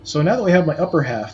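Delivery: ordinary talk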